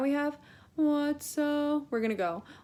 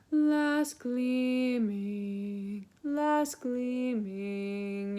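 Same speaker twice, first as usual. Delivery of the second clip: very slow spoken "last gleaming"; much slower than usual. Shown as a demonstration. Teacher marks the hard words slowly. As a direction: draw out the tricky phrase. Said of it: "The trouble here".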